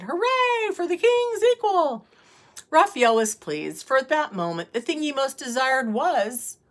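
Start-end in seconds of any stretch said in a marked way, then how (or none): 0.0-2.1 high cheering cry; shouted. A narrator's reading. All the counselors hailing the king's equal, shouted. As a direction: cheer it like a jubilant crowd. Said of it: "all the counselors shouted".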